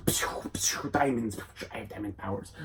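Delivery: soft unvoiced pews; whispered pew sounds. Diamond Head zapping the robot in a whisper. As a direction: whisper the laser noises.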